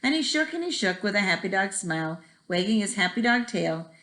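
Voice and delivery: usual speaking voice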